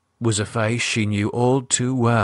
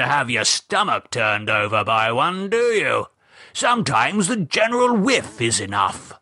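put-on higher, jovial old English gent; Gerald's voice; 4 semitones higher than usual. Gerald musing to his wife about villains, jovial old gent. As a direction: pontificate like a cosy old buffer.

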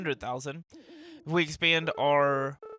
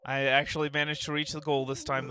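ordinary delivery

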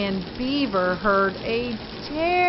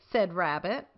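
usual speaking voice

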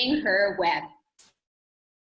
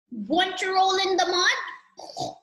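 higher, slow pig invitation; pig character; 6 semitones higher, slower than usual. A narrator's reading. Pig inviting the spider to roll, lightly voiced pig. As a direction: stretch it out as a playful pig. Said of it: "Grunted the pig".

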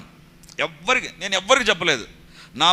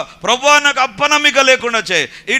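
high, loud cry of prayer; loud plea; 10 semitones higher, 9 dB louder than usual. Acted. Father begging Jesus to remove his unbelief, loud plea. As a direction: cry out forcefully to the Lord. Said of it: "అప్పుడు అంటున్నాడు గట్టిగా".

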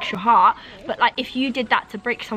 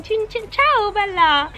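put-on high, exuberant Italian accent; playful, hammed-up Italian greeting, pitched high; 9 semitones higher, slower than usual. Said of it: "They just talk like".

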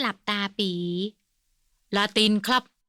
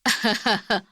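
short amused chuckle; laugh. A narrator's reading. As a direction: laugh at the quarrel. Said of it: "เจ้าคุณนพรัตน์หัวเราะ". (หัวเราะขำ)

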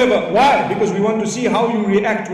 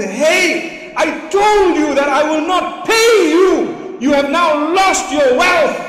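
angry scolding; 5 semitones higher, slower than usual. Retold or imitated. Angry man scolding the milkman.

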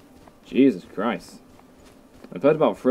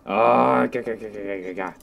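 stuttering nonsense noise; 3 semitones lower than usual. Pretend. Nonsense noise while waiting.

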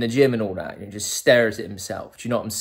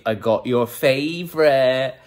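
slow, drawn-out lilting announcement; sing-song sweetheart; 4 semitones higher, 5 dB louder, slower than usual. Acted. Boyfriend brings wine to honey bun, slow sing-song. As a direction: stretch the last word out teasingly.

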